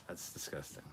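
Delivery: breathy, disgusted whisper under the breath; mock-horrified whisper. Real, not acted. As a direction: recoil from the joke in a hushed voice. Reacting to joke about privates.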